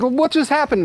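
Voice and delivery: the whacked cat asks, baffled and squeaky; bewildered cat. Acted. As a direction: hit cat wondering what just happened, high and baffled. Whacked cat voiced confused.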